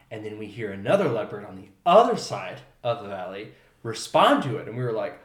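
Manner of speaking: ordinary talk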